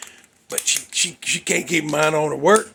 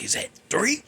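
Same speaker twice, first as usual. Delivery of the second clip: startled question; 11 semitones higher than usual. Retold or imitated. Girl counting crying babies.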